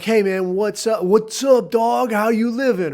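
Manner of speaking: a hammed-up bro "how you living"; mock bro greeting. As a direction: play up the dude-speak to match him. Greets the guy in bro slang.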